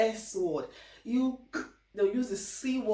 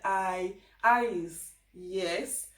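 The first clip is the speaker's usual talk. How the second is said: Scottish aye; much slower than usual. Retold or imitated. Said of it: "they say things like".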